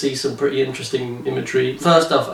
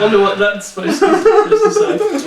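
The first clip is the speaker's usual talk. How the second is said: laughing; 14 semitones higher, 3 dB louder than usual. Real, not acted. Host puzzling over graffiti, breaking into high laughter.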